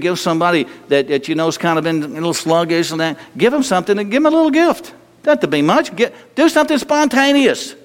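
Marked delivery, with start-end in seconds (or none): 3.4-7.7 much higher, faster, excited push; excited urging. Acted. Pastor exhorting congregation, high and excited. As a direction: rise up and rush it with enthusiasm. Preaching at full force.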